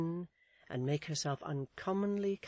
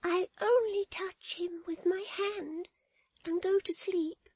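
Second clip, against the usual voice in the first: put-on tearful child; 5 dB louder than usual. A narrator's reading. Florence begs to lie by her brother, tearful child. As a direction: plead as a tearful child, pitched way up. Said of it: "in a voice broken by sobs and tears".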